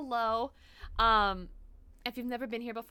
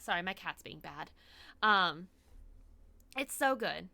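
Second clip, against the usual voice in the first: ordinary talk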